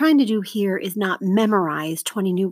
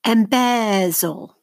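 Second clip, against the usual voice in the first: "Embezzle" slowly, BEZ drawn out; stress on second syllable; much slower than usual. Shown as a demonstration. Teacher models for seventh graders to mark, slow stressed BEZ in "Embezzle". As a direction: say "Embezzle" as em-BEZ-zle, falling away slowly. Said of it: "circle the stress syllable".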